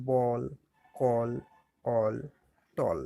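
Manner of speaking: বল, কল, অল, টল each with a rounded "aw" vowel; A pronounced "aw". Shown as a demonstration. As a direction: model বল, কল, অল, টল one at a time with "aw". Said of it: "অ হয়, যেরকম". (বল, কল, অল, টল-এ A-এর উচ্চারণ "অ")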